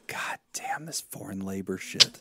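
muttered grumble. Real, not acted. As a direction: grumble it under your breath. Grumbling aside after losing the point.

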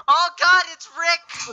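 high, loud panicked cry; sudden terrified yelp; frightened shriek. Real, not acted. Startled by the killer in game.

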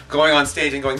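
normal talking voice

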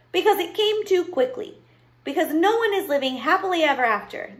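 put-on high, insistent child argument; protesting child. Abilene explains why the ending fails, protesting. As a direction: argue your case in a high voice.

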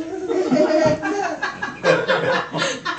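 high gloating cackle; mock evil laugh; villain cackle. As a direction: cackle like a cartoon alien. Villain laugh after threat.

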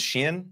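Shein said as "sheen"; alternative pronunciation. Shown as a demonstration. Host offering the name he has heard, Shein as "sheen". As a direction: say Shein with a long "ee" vowel. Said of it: "I've always heard it pronounced".

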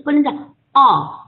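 哦 pushed back in a tight throat; pressed throat sound. Shown as a demonstration.

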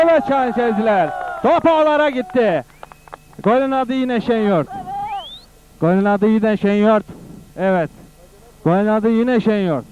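screaming football commentator. Acted. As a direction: shout the goal to the crowd. Goal called by commentator.

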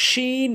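শিন with strong sh and long "ee"; mid-tongue to palate, stretch শিন to "sheen"; correct শিন. Shown as a demonstration. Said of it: "সঠিক উচ্চারণ".

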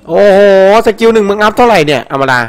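high, loud disbelief at a teammate; loud exasperated scolding. Real, not acted. Scolding teammate's skill choice. (ดุเพื่อนเสียงดังอย่างไม่อยากเชื่อ)

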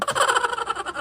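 high, breathy mocking chuckle; a short high giggle of scorn; mocking laugh. Real, not acted. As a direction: laugh at them for crying. Mocking rivals' crying.

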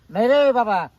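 loud, high-pitched refusal; alarmed protest. Acted. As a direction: protest it loudly, voice shooting up. Alarmed refusal of her threat to leave. (घाबरून मोठ्याने नकार)

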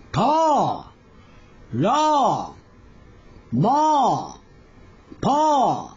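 slow, separated Korean syllables; syllable drill. Shown as a demonstration. Teacher leading pronunciation practice, one syllable at a time. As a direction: say each syllable alone with a clear mouth shape. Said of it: "真ん中の方は、おですから".

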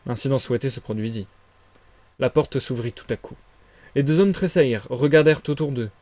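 usual speaking voice

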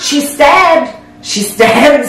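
high, drawn-out exclamation; incredulous. Real, not acted. Telling friends about the pierced plastic, incredulous.

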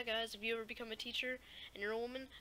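normal talking voice

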